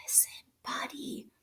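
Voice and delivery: breathy whisper of his name. A narrator's reading. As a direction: whisper it urgently. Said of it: "They tried whispering".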